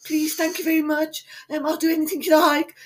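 high, timid pleading; imitating a scared old lady. Frightened old lady at the till, timid and high. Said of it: "She was like".

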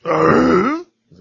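slow, loud guttural grunt; Tim Allen grunt. Retold or imitated. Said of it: "just to do that guttural sound".

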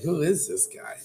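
high, amazed question; incredulous. Retold or imitated. A barista hearing the mentor's numbers, asking in amazed disbelief. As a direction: pitch it up in amused disbelief.

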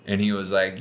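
ordinary delivery

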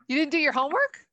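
mock scolding. Teasing partner about notes.

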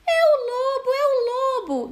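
high little goats shouting together; kids yelling. A narrator's reading. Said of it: "gritaram os cabritinhos".

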